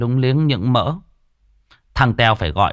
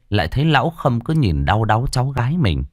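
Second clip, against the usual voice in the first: ordinary delivery, nothing marked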